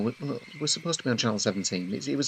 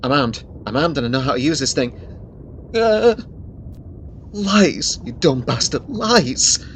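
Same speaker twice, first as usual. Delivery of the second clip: panicked shouting; 8 dB louder than usual. Acted. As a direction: scream him off with a weapon in hand. Threatening an approaching figure.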